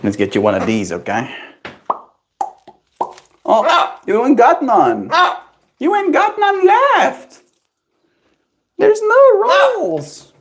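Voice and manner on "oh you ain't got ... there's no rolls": high mock dismay. Real, not acted. Owner tells her bird the treats are gone, slow high baby talk.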